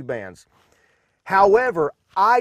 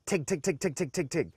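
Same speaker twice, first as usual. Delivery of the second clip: quiet, rapid tig tig ticking; soft tig call; 10 dB quieter than usual. Shown as a demonstration. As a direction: tick out tig softly and fast.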